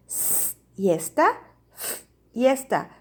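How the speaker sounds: long hissed "S" and "f", then the ending "esta"; first sound isolated. Shown as a demonstration. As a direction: split "S" and "f" off from "esta". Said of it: "Cuál es la diferencia entre siesta y fiesta".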